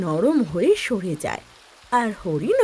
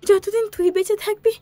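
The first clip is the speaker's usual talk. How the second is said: anguished mother; 10 semitones higher than usual. Acted. Mother rebuking Chinku for making animals homeless, high and anguished. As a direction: warn him, hurt and pleading.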